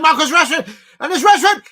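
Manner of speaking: loud, high commentator yell with a laugh; excited goal shout. Real, not acted. Watch-along host erupting as Rashford scores, shouting. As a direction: scream the goal call, laughing with joy.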